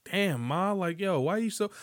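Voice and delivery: high, casual catcall; lightly voiced smooth talker; guy hitting on a girl. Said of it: "approach a girl and be like".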